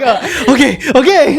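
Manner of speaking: high, loud, laughing surrender; giving in. Retold or imitated. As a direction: act out caving in through laughter. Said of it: "And I'm like".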